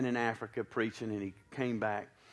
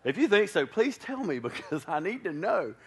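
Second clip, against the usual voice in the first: playful challenge; 7 semitones higher, 6 dB louder than usual. Real, not acted. Joking challenge to the congregation.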